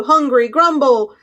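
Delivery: ordinary delivery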